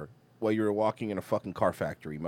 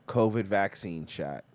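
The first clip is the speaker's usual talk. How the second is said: usual speaking voice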